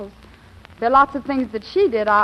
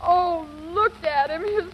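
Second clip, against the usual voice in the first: distressed wail; 7 semitones higher than usual. Acted. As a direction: wail over the injured man, fading at the end. Cries over the knocked-out man.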